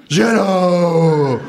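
hoher, lauter, gedehnter Zuruf; laut und gedehnt zustimmen; rufendes Känguru. Acted. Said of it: "ruft das Känguru". (hohe, triumphierende Zustimmung)

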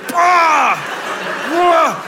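high, loud disgusted boah; loud groan of disgust. Real, not acted. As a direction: groan at the memory. Disgust at the apple saying. (lautes angewidertes Boah)

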